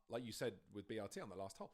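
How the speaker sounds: ordinary talk, nothing marked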